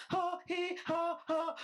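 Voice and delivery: high-pitched fake "ha, he, ha, ha"; mock stilted laugh. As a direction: act out a disbelieving laugh, each ha separate and high. Acts out own stunned reaction.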